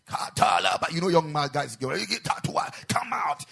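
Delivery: loud, high rebuke of a spirit; commanding exorcism shout. Retold or imitated. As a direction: cast it out with force. Deliverance session commanding demon.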